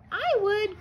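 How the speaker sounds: high, small creature voice; a tiny coral piping up; little coral. A narrator's reading. Coral answers crab.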